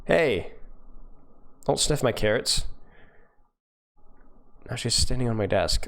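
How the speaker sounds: ordinary delivery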